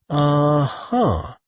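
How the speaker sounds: high, doubtful uh-huh; skeptical, drawn-out acknowledgement; unconvinced. A narrator's reading. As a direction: let it drop at the end, not buying it. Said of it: "sounding wholly unconvinced".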